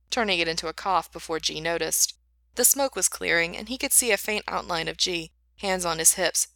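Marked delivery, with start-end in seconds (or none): none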